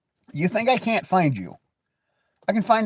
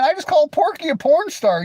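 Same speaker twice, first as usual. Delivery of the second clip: usual speaking voice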